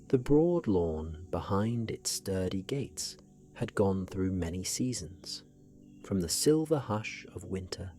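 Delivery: ordinary delivery, nothing marked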